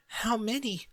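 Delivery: high, soft, breathless amazement; the words fade away in disbelief; awed, trailing off. A narrator's reading. Dinky staring into the box of books, softly awed. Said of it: "her voice trailing off".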